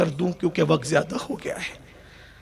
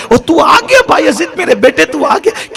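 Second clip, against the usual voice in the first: fast, loud, much higher cry of joy; overjoyed shout; 10 semitones higher, 9 dB louder than usual. Acted. Mother holding her son's face, shouting with joy. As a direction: shout it as if the son were a dream come true.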